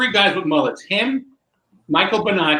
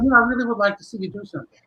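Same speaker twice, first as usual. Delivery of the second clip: insistent Rene; 7 semitones higher, faster than usual. Retold or imitated. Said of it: "He goes".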